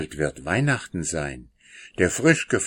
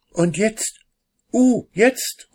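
much higher and louder, bursting with excitement; excited cry; 6 dB louder, slower than usual. A narrator's reading. Brother at the telescope calls out to the family, excited. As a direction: call it out as the moons swim into view. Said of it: "ruft Christoph".

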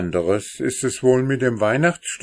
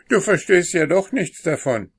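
much higher and louder, scornful; dismissive brother; 10 semitones higher, 5 dB louder than usual. A narrator's reading. Older brother snubs his little sister, dismissive and loud. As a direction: brush the little one off.

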